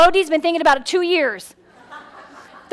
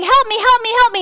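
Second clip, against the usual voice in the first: hammed-up child yelling help; mock kid scream; 6 semitones higher than usual. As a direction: call out like a child in a fire. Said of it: "Kid screaming".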